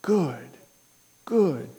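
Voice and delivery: soft, tender murmured praise; quietly approving, like a proud old preacher; gentle approval. Retold or imitated. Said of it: "in a very gentle tone".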